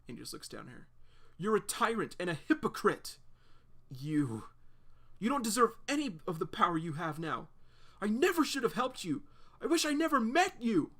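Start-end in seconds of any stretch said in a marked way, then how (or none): 1.4-10.9 reproachful, climbing from raised to high and loud; building to a cry. Acted. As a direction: let it grow until the last line breaks high. Claire confronting John, rising.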